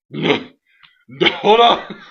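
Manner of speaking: put-on voice, building up in pitch and volume; wrestler protesting. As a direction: play the wrestler waving it off. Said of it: "He's like".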